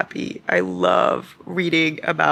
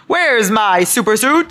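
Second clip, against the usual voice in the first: loud, slow, drawn-out bellow; big movie-quote yell; freeze guy from The Incredibles; 4 semitones higher, 6 dB louder, slower than usual. Acted. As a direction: holler it across the house, pitched up. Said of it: "that freeze guy from The Incredibles".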